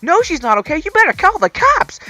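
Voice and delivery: exasperated inner rant. Retold or imitated. Silently yelling at the old lady, high indignant outrage. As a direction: rant at her in disbelief. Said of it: "I'm thinking in my head".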